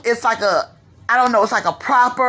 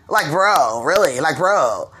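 lightly imitated Chicago drawl, much lower; Chicago slang accent; 9 semitones lower, slower than usual. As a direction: mimic the Chicago guys' bro talk, deep and lazy. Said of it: "But it's that sound".

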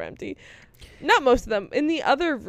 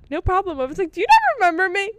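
mock chirpy reply; 9 semitones higher, 6 dB louder than usual.

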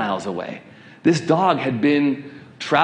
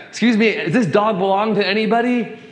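raised, high-pitched appeal to a crowd; calling out; 8 semitones higher than usual. Retold or imitated. Pastor asking passers-by a second time, calling out. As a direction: call out for anyone's attention. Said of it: "second time".

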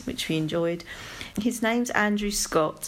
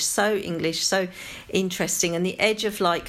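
normal talking voice

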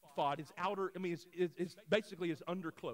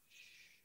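soft drawn-out shh; hushing; 26 dB quieter than usual. Retold or imitated. Dad teaching his two-year-old golf quiet, soft shushing. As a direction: hush a small child gently.

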